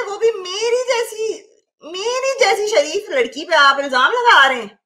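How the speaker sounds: hammed-up injured innocence, drawing words out; mock outrage. Streamer protests a viewer's accusation against her, hammed-up outrage.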